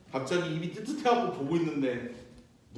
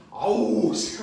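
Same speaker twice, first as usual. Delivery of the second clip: mild annoyed groan; 4 dB louder, much slower than usual. Retold or imitated. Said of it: "하고 일어났는데".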